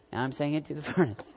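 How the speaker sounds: normal talking voice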